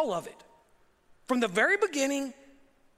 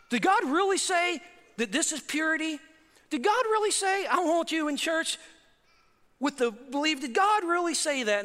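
high, insistent mock questioning; doubting culture; 6 semitones higher than usual. Preacher voices culture questioning church belief, high and doubting. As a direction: challenge it in a raised, incredulous pitch. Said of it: "Our culture's still doing that today".

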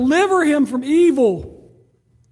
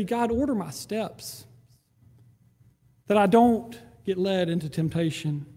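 hushed humble prayer; quiet earnest prayer; 5 semitones lower, 5 dB quieter than usual. Acted. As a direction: pray it under your breath.

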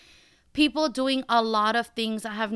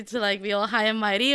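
ordinary talk, nothing marked

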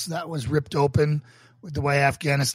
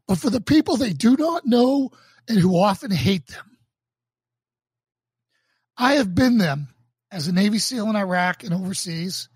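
earnest, emphatic sermon delivery, swelling louder on key phrases; sermon reading; 2 semitones higher, 3 dB louder than usual. Acted. Pastor reading his sermon to online viewers, earnest and emphatic. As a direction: preach it from the page with conviction.

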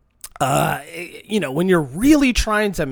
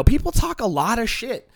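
ordinary delivery

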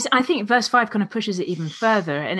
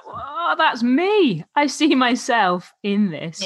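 high, drawn-out "oh" of recognition; acted realisation; 6 semitones higher, slower than usual. Reader recognising themselves in the passage, acted realisation. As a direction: imitate readers suddenly seeing themselves, pitched up and slow.

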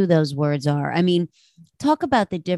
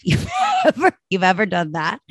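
laughing; faster than usual. Real, not acted. Host asking listeners if they've ever done that, laughing. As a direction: break up laughing at yourself mid-question. Laughs at her own meltdown.

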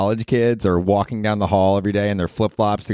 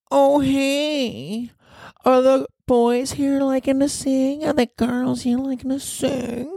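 very high, slow, over-spoken stage banter; much slower than usual. Retold or imitated. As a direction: overdo every word in a high drawn-out voice. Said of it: "She's, like, over speaking like that".

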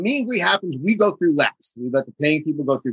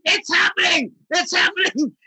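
high, giddy phone-call yell; reenacted high-pitched jubilation; excited shouting; 13 semitones higher than usual. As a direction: shriek the big news to a friend. Reliving excited call to his friend.

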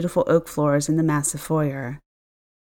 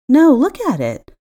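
urgent insistence; 8 semitones higher, 7 dB louder than usual. A narrator's reading. Insisting the window changed.

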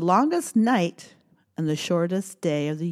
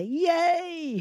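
demo cheer; 10 semitones higher than usual. Shown as a demonstration. Modelling the cheer for kids.

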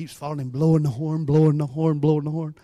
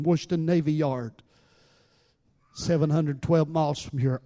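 usual speaking voice

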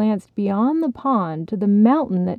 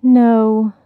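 frowning no; 6 dB louder than usual. Acted. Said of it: "the frog frowned".

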